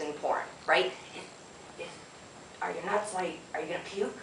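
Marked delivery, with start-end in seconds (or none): 1.2-4.2 hushed aside to a partner, much softer; softly checking on a partner mid-scene; stage whisper. Shown as a demonstration. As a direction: drop to a near whisper to check in. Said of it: "The stage whispers".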